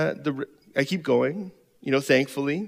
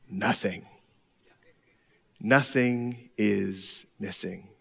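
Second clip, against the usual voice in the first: soft, very slow; gentle divine answer; 8 semitones lower, 6 dB quieter, much slower than usual. Retold or imitated. God answering the weary pastor, soft and slow. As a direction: let Nothing land quietly.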